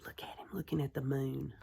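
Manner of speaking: fast hushed aside; quick whisper. Real, not acted. Aside to children over picture.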